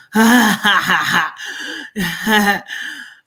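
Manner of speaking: loud, bursting cackle; big belly laugh. Real, not acted. Reader cracking up over the vitamin remark, loud laughter. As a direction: crack up at your own joke.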